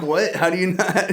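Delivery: baffled, incredulous questioning with a laugh; astounded. Retold or imitated. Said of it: "I'm always really astounded".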